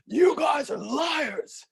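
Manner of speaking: high, slow furious shout; angry yell. Retold or imitated. Angry guest yelling at the front desk clerk. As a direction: bellow the accusation at the hotel clerk. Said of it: "he's yelling".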